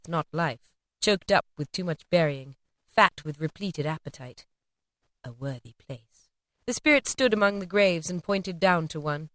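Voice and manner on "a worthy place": very soft, slow and low; soft bitter murmur over the grave; grim hush. A narrator's reading. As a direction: let it sink like a dark joke. Grim repeated phrase.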